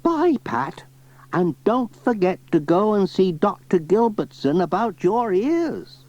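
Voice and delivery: put-on high old lady voice; chatty old granny, pitched high. Granny Dryden asking Pat about the spade, high old voice.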